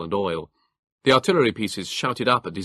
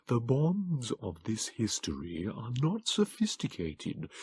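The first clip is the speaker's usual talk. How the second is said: slow, high, softer creature voice; calm Morlock; 6 semitones higher, 4 dB quieter, slower than usual. A narrator's reading. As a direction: explain patiently, thin and quiet. Morlock figure.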